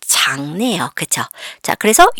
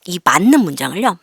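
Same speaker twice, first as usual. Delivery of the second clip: ordinary talk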